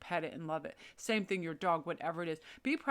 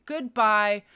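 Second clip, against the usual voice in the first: a louder, abrupt "Goodbye!" thrown out; 9 dB louder than usual. Pretend. Telling a bad partner off with a loud, emphatic goodbye. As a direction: cut them off with a loud, cheery farewell. Leaving a stagnant job.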